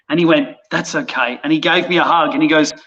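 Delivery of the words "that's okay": much softer and lower, kind; gentle consoling. Retold or imitated. Skipper hugging the deckhand, soft and kind. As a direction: let him down gently.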